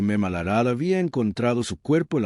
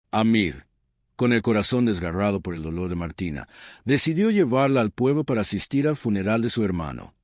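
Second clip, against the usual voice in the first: ordinary delivery, nothing marked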